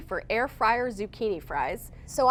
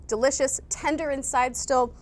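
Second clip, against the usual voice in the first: ordinary delivery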